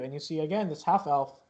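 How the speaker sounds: ordinary talk, nothing marked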